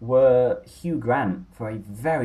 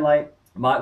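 ordinary talk

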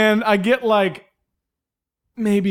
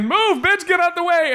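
high, mock-shouted heckle; crowd heckle. Rowdy audience heckling the comic to leave, high mock shout. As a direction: yell him off the stage.

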